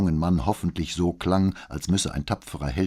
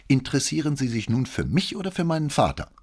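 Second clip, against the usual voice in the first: higher-pitched, testy challenge; irritated; 8 semitones higher, 4 dB louder, faster than usual. A narrator's reading. Boy challenging questions about his father, irritated. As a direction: turn the question back on him with irritation. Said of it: "fragte der Junge gereizt".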